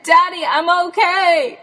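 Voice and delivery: high, loud call from far off; shouting back. Retold or imitated. Stuck Jen answering her searching dad, shouting high and loud. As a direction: yell so he can hear you.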